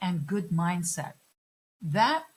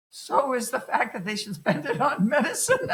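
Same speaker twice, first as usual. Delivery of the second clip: teasing comeback through a laugh; laughing retort; 5 semitones higher than usual. Retold or imitated. Teacher jokingly answers the student, laughing. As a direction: toss it back while laughing.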